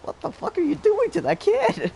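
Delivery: high, loud imitated shocked girl. Girls at recess shocked at the yelling, mimicked high and loud. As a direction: mimic the appalled girls staring. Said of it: "half of them look at me, like".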